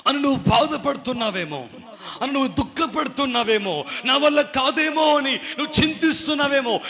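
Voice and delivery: high, building exhortation; impassioned preaching. Real, not acted. Preacher naming the listeners' worries, impassioned. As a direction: climb with each repeated phrase.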